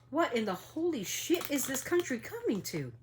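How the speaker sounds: shocked at the news; incredulous outrage; outraged disbelief. Real, not acted. Outraged reaction.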